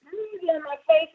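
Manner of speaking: ordinary talk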